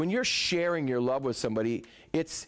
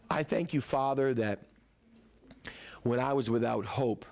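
tender prayer; 5 semitones lower than usual. Acted. Preacher keeps praying gently to Jesus, low and tender. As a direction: speak it like a close friend.

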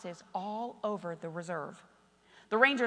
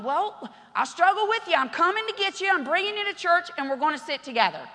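higher, louder eager reply; eager promise; 6 semitones higher, 6 dB louder than usual. Retold or imitated. Pastor promising to bring Mike to church, high and loud. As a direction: rush to his side.

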